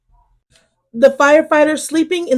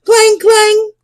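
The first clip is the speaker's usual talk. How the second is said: clanging bell; 8 semitones higher, 5 dB louder than usual. A narrator's reading. Fire engine bell sounds.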